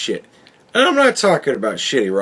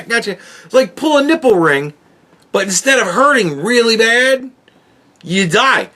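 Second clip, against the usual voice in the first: normal talking voice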